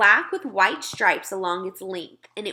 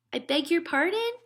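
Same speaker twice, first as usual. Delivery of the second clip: put-on higher, puzzled girl's voice; a small girl asking politely; higher child voice; little girl Sophie; 8 semitones higher than usual.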